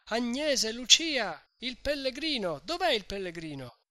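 high calls into the empty house; calling out names. A narrator's reading. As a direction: call out for Agnese and Lucia. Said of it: "Si chiama".

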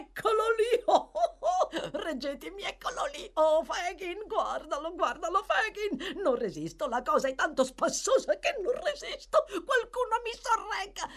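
put-on high, loud boy laughing through every word; can barely stand for laughing; helpless laughter. A narrator's reading. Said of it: "dai cui polmoni era scaturita la risata".

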